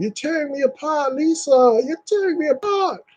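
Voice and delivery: high, strained anguished cry; high tortured cry from the movie; movie quote again. Retold or imitated. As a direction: wail it like the melodramatic hero. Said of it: "You can see it move".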